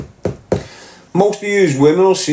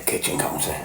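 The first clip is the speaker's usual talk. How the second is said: whisper; 12 dB quieter than usual. Retold or imitated. Whispered aside.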